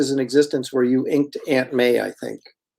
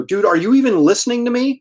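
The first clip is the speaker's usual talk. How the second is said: angry retort; 9 semitones higher than usual. Retold or imitated. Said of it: "I was so mad".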